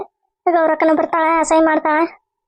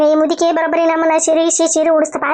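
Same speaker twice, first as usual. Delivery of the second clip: ordinary delivery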